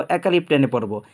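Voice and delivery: ordinary delivery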